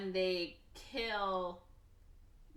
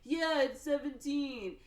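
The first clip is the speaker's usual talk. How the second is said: high, slow, drawn-out mock delight; retold excitement; 9 semitones higher, 6 dB louder, slower than usual. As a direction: echo it loudly, playfully exaggerated. Echoes the weird excited greeting.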